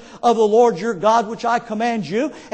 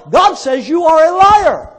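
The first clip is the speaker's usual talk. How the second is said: loud, raised, slow preaching shout; thundering accusation; 5 semitones higher, 8 dB louder, slower than usual. Real, not acted. Preacher rebuking those who alter scripture, thundering accusation. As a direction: build to a drawn-out, hard-hit last word.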